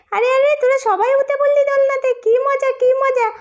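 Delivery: thrilled, squealing about the swing; excited. Acted. Child cheering as everyone climbs the swing, excited.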